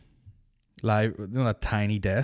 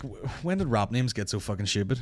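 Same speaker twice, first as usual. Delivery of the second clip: usual speaking voice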